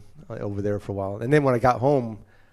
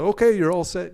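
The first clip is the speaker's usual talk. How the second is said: higher, louder, lightly voiced officer; officer dismissing driver; 8 semitones higher, 6 dB louder than usual. Police releasing the sober driver, lightly imitated brisk dismissal. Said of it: "so they were like".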